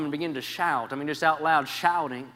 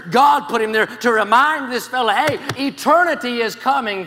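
loud, high, fervent preaching; full-voiced revival preaching; preaching at full force; 8 semitones higher, 12 dB louder than usual. Real, not acted. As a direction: preach it out to the back row.

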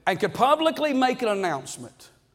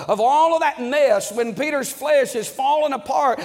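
fervent preaching; 4 semitones higher than usual. Acted. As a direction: raise the voice and drive the point home to the church. Preaching builds up.

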